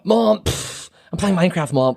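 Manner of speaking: high, mock-annoyed kid whining at mom; angry kid. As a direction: complain up at mom like a peeved kid. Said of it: "an angry kid yelling at his mom".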